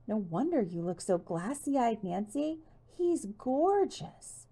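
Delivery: high, slow, swooning gush; breathless gushing. A narrator's reading. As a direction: swoon over him. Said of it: "she said breathlessly".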